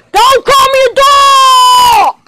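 high, drawn-out play yell; outraged yell. Pretend. Toy insulted as a doghead, outraged high yell. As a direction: wail the protest high and slow. Protests at being called doghead.

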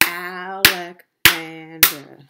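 Alexander slowly in four loud beats, Al-ex-an-der; syllable by syllable. Shown as a demonstration. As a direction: punch out each syllable of Alexander, drawn out. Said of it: "how many parts a word can be broken into".

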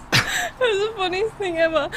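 high, giggly and tearful; crying with laughter. Real, not acted. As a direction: still cracking up while talking. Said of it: "I'm actually crying".

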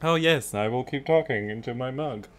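jokey mock self-quote. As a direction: playfully parrot yourself. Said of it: "I'm just like".

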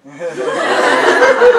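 very high, loud burst of laughter; cracking up in disbelief; high squealing laugh; shrieking laugh. Real, not acted. Shock at lady's remark.

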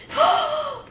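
sharp high delighted gasp; excited gasp. Real, not acted. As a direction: suck in air in joyful shock. Seeing the package contents.

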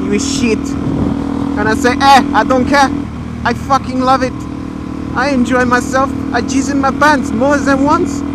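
hammed-up French accent. Vlogger answering imagined critics, put-on French accent. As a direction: play a mock Frenchman, pitch lifting with the boasting. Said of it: "it's kinda French".

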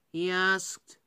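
ordinary delivery, nothing marked

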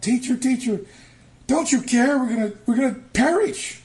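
high, loud, panicked pleading; panicked cry. Acted. Disciples waking their teacher in the storm, panicked high yell. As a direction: cry out in terror over the storm.

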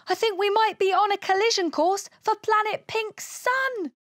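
high-pitched rising panic; frightened, high alien voice; alarmed. Acted. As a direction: blurt it as a crash looms. Alien fears an imminent crash.

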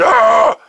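short, loud startled yelp, pitched high; retold scream. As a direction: let out a sudden fright scream at the spider. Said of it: "I screamed".

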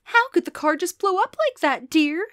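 high, fretting wife's question; worried mom. A narrator's reading. Mom's worried question voiced.

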